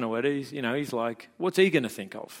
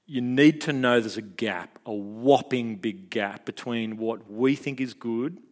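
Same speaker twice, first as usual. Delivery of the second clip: usual speaking voice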